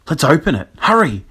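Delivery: high, urgent excitement; excited and raring to go; eager. Acted. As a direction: urge your friends on impatiently. Jack dances round the chest, eager.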